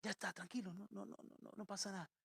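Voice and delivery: dad soothing his son over the smoke; calm reassurance. Retold or imitated. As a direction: quietly settle the frightened boy. Father calming the panicked son.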